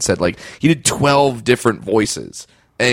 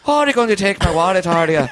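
put-on high, quick leprechaun Irish accent; 9 semitones higher than usual. Mugging victim taunting the mugger, leprechaun Irish. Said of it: "go for the leprechaun Irish".

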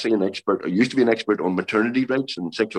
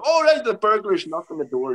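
higher, sudden penny-drop voice; dawning realisation; 10 semitones higher than usual. Retold or imitated. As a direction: sound like it just clicked. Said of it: "and you go".

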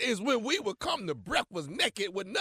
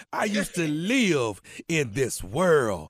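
ordinary delivery

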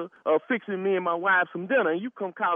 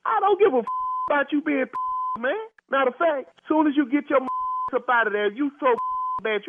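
angry yelling; 9 semitones higher than usual. Real, not acted. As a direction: yell it at him, fed up and furious. Prank argument heats up into threats.

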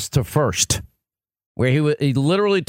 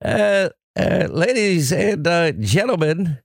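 Bob Grant impression; 3 semitones higher, slower than usual. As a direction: drag out the outrage. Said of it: "Bob Grant is very good".

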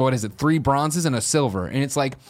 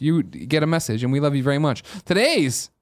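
ordinary delivery

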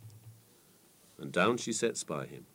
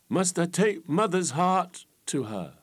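fool's voice; 10 semitones higher, 5 dB louder, slower than usual. A narrator's reading. Fool fretting over the riddle.

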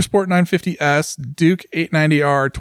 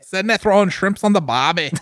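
hammed-up, badly faked Australian accent; 4 semitones higher than usual. Co-host mocking Australians from Down Under, horrible Australian accent. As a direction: play a cartoon Australian accent, slightly higher. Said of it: "horrible Australian accent".

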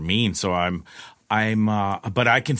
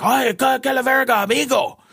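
high, louder shocked cry in Spanish; startled Spanish exclamation; 13 semitones higher, 4 dB louder than usual. Retold or imitated. Driver startled by a swollen stranger at his door, high shocked Spanish yelp. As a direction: jump out of your skin and yelp it in Spanish.

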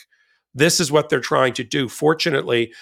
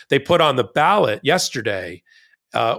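ordinary delivery

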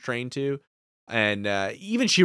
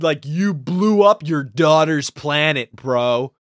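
ordinary talk, nothing marked

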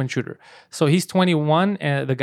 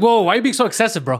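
quick, raised mock judge voice; mock offended judge; 5 semitones higher, faster than usual. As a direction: play an indignant judge, fast and higher. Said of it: "says something like".

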